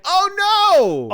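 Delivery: high, drawn-out mock gasp of horror; over-the-top movie-audience shock; mock dismay. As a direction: wail it in exaggerated alarm. Acting the intended audience shock.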